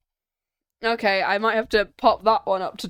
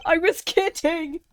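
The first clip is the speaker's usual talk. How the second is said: laughing it off; 11 semitones higher, 9 dB louder, slower than usual. Real, not acted. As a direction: giggle through the words. Laughs after silly line.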